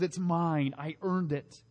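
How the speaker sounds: quiet. Shown as a demonstration. Preacher repeating the selfish claim, quiet and falling. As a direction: let it drop to a murmur. Same words, dropped quietly.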